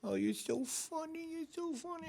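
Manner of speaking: tiny mock-adoring voice; fawning old lady. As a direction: coo it sweetly as you pinch a cheek. Old ladies fawn, grabbing his face.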